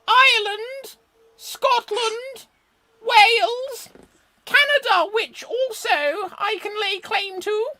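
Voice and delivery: warm regal boom, very high and loud; Queen of England. Pretend. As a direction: boom it out like a proud monarch. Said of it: "I am going to be the Queen of England".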